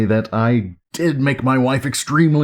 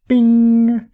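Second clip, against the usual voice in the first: high, loud, drawn-out bing; imitated bing; 11 semitones higher, 5 dB louder than usual. Reviewer switching on the radio's light for viewers, playful high bing. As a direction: sing out a ringing "bing" on one held note.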